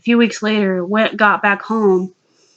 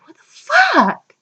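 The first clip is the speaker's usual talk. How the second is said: high, drawn-out exclamation; high-pitched slow mock shock; retold shock; slower than usual. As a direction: act out appalled disbelief, stretching the last word. Retold reaction to floating clown heads.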